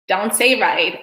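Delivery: usual speaking voice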